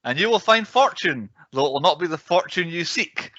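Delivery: prophesy the episode like a Southern soothsayer; mock old-timey Southern accent. Host foretelling a perilous podcast, hammed Southern accent. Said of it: "I don't know if that was an offensive accent or not".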